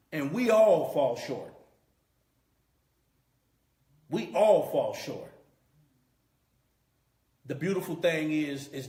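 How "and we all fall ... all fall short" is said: louder, driving sermon emphasis on all; fervent pulpit repetition; preaching at full force. Real, not acted. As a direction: press the point home to the congregation.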